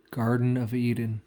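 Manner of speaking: ordinary talk, nothing marked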